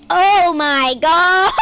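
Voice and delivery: loud, high, drawn-out cry breaking into laughter; laughing shock. Real, not acted. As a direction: shriek it slowly, then crack up. Watching the ragdoll fall, laughing.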